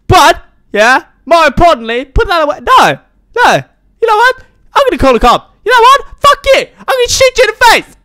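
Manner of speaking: high, loud squealing tirade; hammed-up rage. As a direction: build from shrill-pitched scolding to mock screaming. Escalating mock threats at wife.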